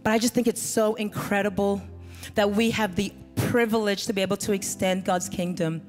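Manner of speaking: ordinary talk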